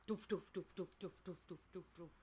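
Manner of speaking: doof noises fading to quiet; fading doof sounds. Acted. Lights shutting down one by one.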